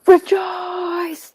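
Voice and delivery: very high, drawn-out cheer; mock jubilant shout. Co-host joins the rejoicing, high long shout. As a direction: belt it out in mock celebration.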